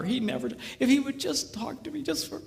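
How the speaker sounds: mock tearful woman. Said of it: "And she's just crying".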